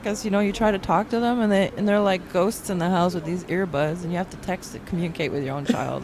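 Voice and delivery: usual speaking voice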